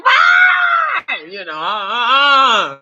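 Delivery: very high loud scream then stammer; mock scream. Speaker at the water park seeing a bug, high scream. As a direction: shriek at the bug, hammed-up. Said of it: "I was like".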